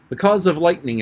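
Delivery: decided, sure of the facts. A narrator's reading. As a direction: begin the answer with confidence. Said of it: "Alice said very decidedly".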